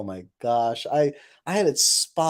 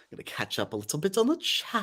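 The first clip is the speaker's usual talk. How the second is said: playful rising lilt; 6 semitones higher, 5 dB quieter than usual. Pretend. Playful first take.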